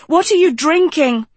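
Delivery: sharp, high alarmed question; demanding. Acted. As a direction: demand it urgently.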